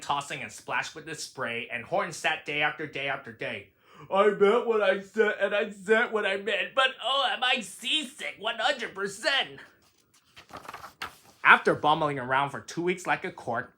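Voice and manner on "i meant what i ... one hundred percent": lightly voiced elephant, rising queasy on "oh"; a hint of a woozy elephant; gentle put-on voice, seasick; seasick Horton. A narrator's reading.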